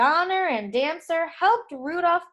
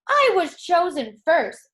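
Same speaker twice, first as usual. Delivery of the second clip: sharp, louder insistence; angry reindeer; 5 semitones higher, 6 dB louder than usual. Acted. Dasher squabbling with Dancer, cross and loud. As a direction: stamp your claim to go first. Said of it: "stomping angrily".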